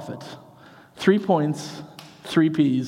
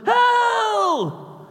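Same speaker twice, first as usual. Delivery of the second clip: shouted hell. Retold or imitated. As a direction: bellow it once more in desperation. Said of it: "So I just went".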